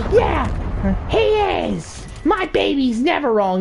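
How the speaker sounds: high, indignant mom voice; mock defensive mother. Mother backs her son to cop, mock defensive mother. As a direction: imitate a mother squawking in her child's defense.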